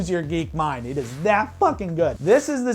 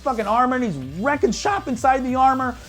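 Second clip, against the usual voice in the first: raised and louder, tumbling out with fanboy glee; excited gushing; 5 semitones higher, 3 dB louder than usual. Real, not acted. Reviewer raving to viewers about the airport fight, excited and loud. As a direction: rave to the audience like you can't contain it.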